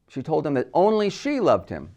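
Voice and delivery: single out "only she"; stress on ONLY SHE. Shown as a demonstration. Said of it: "Placing the word only".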